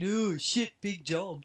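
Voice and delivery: mock unimpressed lad, tossed off softly. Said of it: "in a don't really give a shit about anything".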